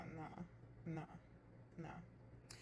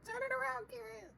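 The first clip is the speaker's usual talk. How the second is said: much higher mock cheer, playfully egging her on; cheeky call-out. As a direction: squeal it like hyping up the table. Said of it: "And I'm just like".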